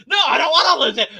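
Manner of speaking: loud, fast, high protest; mock refusal shout. Deconverted Man answering his fans, loud fast protest. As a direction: shout that you won't lose it.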